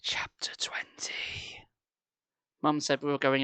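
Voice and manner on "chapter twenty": slow spooky whisper; hushed announcement. A narrator's reading. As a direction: breathe the chapter title. Whispered chapter heading.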